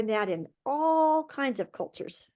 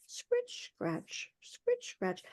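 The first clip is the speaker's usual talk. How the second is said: whispered, much softer scritch scratch; hushed carding sound; 13 semitones higher, 10 dB quieter, much slower than usual. A narrator's reading. Teller mimics the wool cards as the leader peeps in, in a hush. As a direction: breathe the scraping out almost silently.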